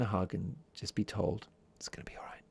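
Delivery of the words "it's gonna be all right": soft whispered comfort. Shown as a demonstration. Host whispering comfort to sleepy listeners, whispered. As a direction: breathe it like comfort in a hug. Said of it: "I whisper that".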